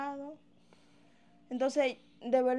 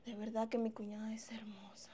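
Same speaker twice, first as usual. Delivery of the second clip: secret whisper to herself; hushed scheming aside; 3 semitones lower, 9 dB quieter than usual. Acted. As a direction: breathe it out so nobody hears. Scheming aside about sister-in-law.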